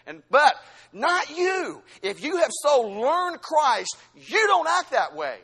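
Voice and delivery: loud, high preacher's exhortation with drawn-out words; big, ringing, measured pulpit delivery; forceful preaching. Real, not acted. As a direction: preach it slowly and emphatically at full voice.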